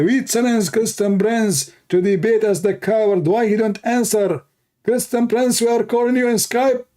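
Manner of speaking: high whiny taunting imitation. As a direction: mimic them calling out smugly at a funeral.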